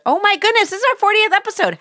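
high, fast burst of surprise; suddenly thrilled, racing through it; delighted surprise. Real, not acted. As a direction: gasp at the milestone you just noticed. Realizes fortieth episode milestone.

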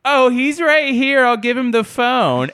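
put-on very high, slow old lady voice; high slow grandma voice; sweet old wife. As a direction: hand over the phone sweetly, slowly. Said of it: "she goes".

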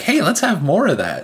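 high-pitched pretend enthusiasm; hammed-up, high and eager; mock eagerness. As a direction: play an over-keen player egging it on. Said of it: "you're just like".

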